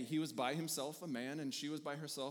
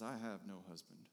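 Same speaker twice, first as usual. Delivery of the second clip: quiet crushed admission; 8 semitones lower, 8 dB quieter than usual. Acted. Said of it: "Can you imagine".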